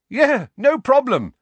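loud, high false cheer; horribly hearty. A narrator's reading. Boy lending owl to his friend, horribly hearty. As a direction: boom it out far too heartily. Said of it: "still in the horribly hearty voice".

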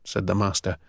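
ordinary delivery

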